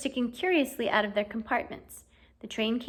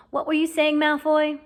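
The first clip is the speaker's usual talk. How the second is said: higher, louder challenge; challenging; 5 semitones higher, 6 dB louder than usual. Acted. Harry confronts Malfoy, loud and challenging. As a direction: square up and throw it back at him.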